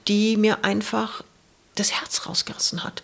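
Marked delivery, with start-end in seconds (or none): 1.8-3.0 whispered pain. Real, not acted. Erzählt von tiefem Schmerz.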